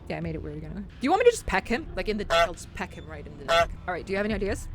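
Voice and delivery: ordinary talk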